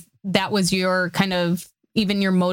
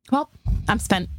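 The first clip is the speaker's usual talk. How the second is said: movie quote. Retold or imitated. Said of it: "do you know what that's from".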